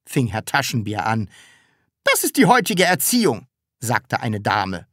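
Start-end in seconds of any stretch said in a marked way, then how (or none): 2.0-4.9 high-pitched disapproval; indignant lady. A narrator's reading. Lady scolding a child's father, indignant.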